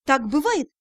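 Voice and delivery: plain reading voice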